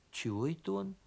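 soft, hushed wondering; puzzled inner thought. A narrator's reading. Donkey wondering about the hiding bear cub, hushed. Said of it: "снова подумал ослик".

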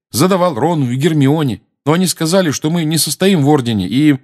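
indignant protest. A narrator's reading. Harry's indignant protest goes on.

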